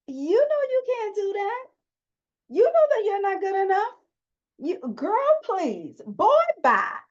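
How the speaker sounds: high, mocking put-on voice of the inner critic; a high sassy voice that jeers at you. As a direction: sneer like a catty critic. Voicing the sneering inner critic.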